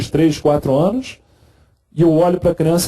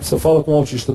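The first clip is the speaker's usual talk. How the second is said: ordinary delivery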